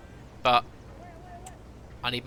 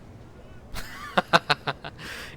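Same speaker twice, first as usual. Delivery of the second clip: laugh; 4 semitones higher than usual. Real, not acted. Laughs at own blunder.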